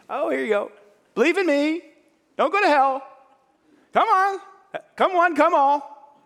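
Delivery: high, loud hammed-up carnival barker; mock cheap salesman Jesus. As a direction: hawk salvation like a cheap fairground giveaway. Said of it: "get out of hell free ticket".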